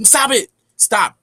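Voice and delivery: high, loud snap; sharp mock scold. Woman cutting off the man, loud mock scold. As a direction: cut him off hard.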